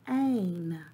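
ordinary talk

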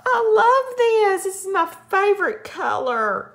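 real shriek of excitement; excited scream. Real, not acted. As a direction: scream with sheer delight. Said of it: "I'm starting to scream".

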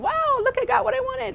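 high, squealing delight over a present; thrilled Christmas morning squeal; excited child. Retold or imitated. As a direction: gasp it out like a kid opening gifts. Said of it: "and all of a sudden".

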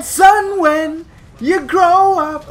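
high, slow, drawn-out father's voice; hammed-up fatherly speech, high and slow; emo Kylo Ren. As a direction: drag it out with mock gravity. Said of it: "too carried away with emo Kylo Ren".

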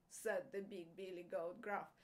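usual speaking voice

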